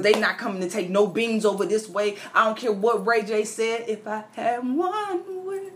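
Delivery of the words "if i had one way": mock high voice. Mock high voice, then "just playing.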